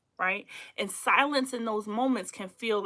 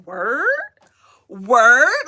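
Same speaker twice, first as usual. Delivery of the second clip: "Word?" rising, the second one much louder; confrontational word; 11 dB louder than usual. Shown as a demonstration. Showing the audience a confrontational "Word?", rising and loud. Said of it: "we about to have a fight".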